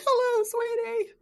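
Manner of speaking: high, slow, sing-song sweet greeting; drawn-out, affectionate phone hello; doting dad. Retold or imitated. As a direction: imitate a dad cooing to his daughter. Imitating her doting dad answering a call.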